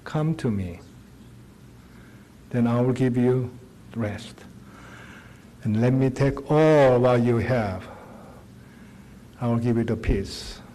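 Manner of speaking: a quiet, tender "come to me"; gentle invitation. Acted. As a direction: invite them in, don't command. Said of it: "Come is invitation".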